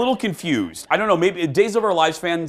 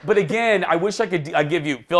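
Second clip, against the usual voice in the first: ordinary delivery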